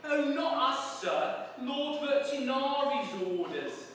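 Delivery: hammed-up cockney warder; gloating warder. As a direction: crow it like a smug jailer. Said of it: "said the warder".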